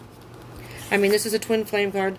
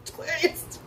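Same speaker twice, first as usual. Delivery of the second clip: laughing whisper; 7 semitones higher, 8 dB quieter, slower than usual. Real, not acted. Laughing after shocked outburst.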